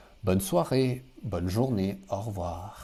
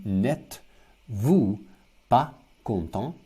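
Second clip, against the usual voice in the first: slow model; 3 dB louder, slower than usual. Shown as a demonstration.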